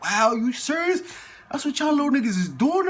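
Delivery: high, incredulous retelling; imitated disbelief. Cellmates react in disbelief.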